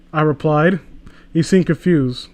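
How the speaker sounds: usual speaking voice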